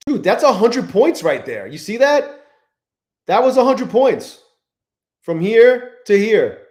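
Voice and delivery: real excitement at a big price jump; excited. Real, not acted. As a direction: point at the screen, thrilled. Price jumps a hundred points.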